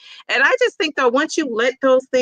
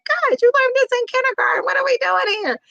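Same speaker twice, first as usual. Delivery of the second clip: much higher, incredulous teacher voice; exasperated teacher. Retold or imitated. Teacher scolding kids who forgot kindergarten lessons, much higher, incredulous. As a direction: throw up your hands at the kids. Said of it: "but I have said".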